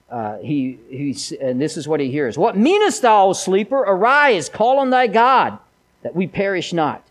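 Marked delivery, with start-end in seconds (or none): 2.4-7.0 put-on loud, higher, shouting old-English sailor; yelling mariner. The mariner yelling in Jonah's face to wake him. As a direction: bark the mariner's rebuke at a sleeper. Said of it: "in his face yelling".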